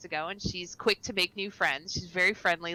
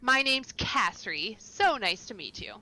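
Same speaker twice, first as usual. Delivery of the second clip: bubbly, raised voice of a sociable local; friendly chatty half-elf; 4 semitones higher, 4 dB louder than usual. Acted. Castry greets the new arrival at the bar, bubbly. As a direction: gush at the newcomer.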